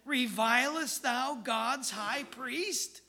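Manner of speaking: accusing onlookers. Acted. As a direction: drag it out as a scandalised accuser. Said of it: "those that stood by said".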